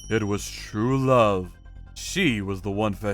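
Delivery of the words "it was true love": usual speaking voice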